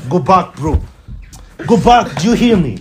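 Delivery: angry shout. Acted. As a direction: shout him back out the door. Angry quarrel, ordering him away.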